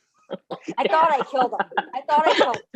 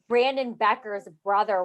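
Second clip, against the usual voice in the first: ordinary delivery, nothing marked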